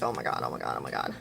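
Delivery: very low, hushed repeated murmur; imitated nervous panic. She walks out wrapped in a towel, hushed nervous panic. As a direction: panicky inner chant, kept under the breath. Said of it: "she's super nervous".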